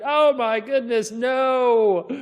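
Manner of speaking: put-on high, loud horrified gasp of a boy; shocked. Steve seeing the ugly fish, loud shocked cry. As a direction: recoil in mock horror. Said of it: "He's like".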